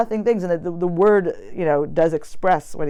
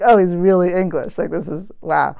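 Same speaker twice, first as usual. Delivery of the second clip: loud, startled "oh, he's really English"; retold moment of shock; shocked realization; 3 semitones higher, 5 dB louder than usual. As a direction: blurt it out as the surprise lands. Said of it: "that was kind of a shock".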